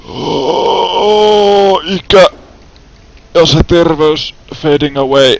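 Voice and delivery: theatrical mock grief over lost health; mock dramatic lament. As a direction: ham up the tragedy of growing old. Character ages, mock lament.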